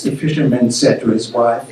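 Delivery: ordinary delivery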